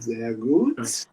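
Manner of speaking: hammed-up German, swooping up at the end; flamboyant German accent. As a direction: approve like a flamboyant German director. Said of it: "flamboyant German accent".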